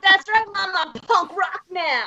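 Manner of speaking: hammed-up kid bragging to mom; punk kid to mom. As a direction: play your young punk self, stretching it out. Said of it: "I'm like".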